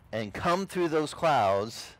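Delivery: ordinary talk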